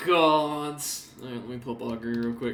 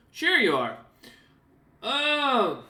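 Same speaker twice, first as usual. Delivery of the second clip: higher, louder doubtful voice; skeptical NPC; 10 semitones higher, 5 dB louder, slower than usual. Acted. Scartsmander doubting Keswick's claim, higher and sceptical. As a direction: sound unconvinced. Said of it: "Kinda nods and goes".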